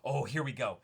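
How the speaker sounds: ordinary delivery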